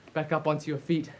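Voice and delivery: ordinary delivery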